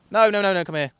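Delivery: high, loud, urgent pleading; frantic no-nos. Real, not acted. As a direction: grab at it with your voice. Eel escaping again.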